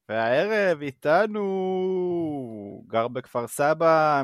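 slow, building guest introduction; announcer intro. Acted. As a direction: stretch out the guest's name.

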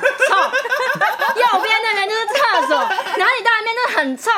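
high laughing complaint, slow at first; real giggling complaint. Real, not acted. Laughing while complaining about smell.

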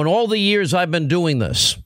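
ordinary talk

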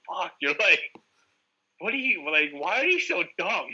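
lightly voiced taunting parents; mock taunting parents. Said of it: "They're like".

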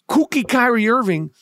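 ordinary talk, nothing marked

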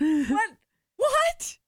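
very high, incredulous cry; shocked disbelief. Real, not acted. Friend hearing he asked her to lie, shocked. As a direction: squeak it out in disbelief. Listener appalled by the lie request.

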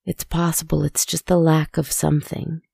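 ordinary delivery, nothing marked

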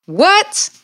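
high-pitched, rising cry of disbelief; amazed reaction. Real, not acted. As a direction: react astonished, pitch shooting way up. Amazed reaction to the quoted line.

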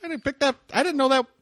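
very high, loud, frantic comic voice; Eduardo Murphy impression. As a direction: squeal it out flustered and defensive. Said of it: "Eduardo Murphy impression ever".

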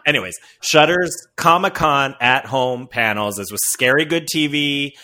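ordinary talk

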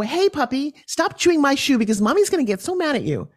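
high, sing-song voice talking down to a dog; baby talk to a puppy. Retold or imitated. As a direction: coo at the puppy like it's a baby.

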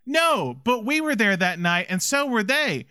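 a hint of an earnest young woman's plea; imitating Baby. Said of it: "She's like".

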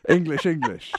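mock posh English accent. Co-host showing off her English accent, clipped and proper. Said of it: "your favorite English accent".

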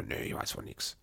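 softly unvoiced, innocent denial; mock clueless father-in-law. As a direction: whisper it like a clueless old man. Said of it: "Sagt er". (ahnungsloser Schwiegervater, geflüstert)

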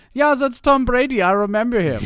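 high, loud hammed-up German accent from Munich; mock Munich accent. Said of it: "that's a good Munich accent".

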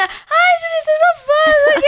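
very high squealy mock voice; gushing girl fan. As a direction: imitate a thrilled fan at carnival. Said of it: "uma menina".

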